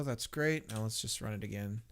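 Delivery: normal talking voice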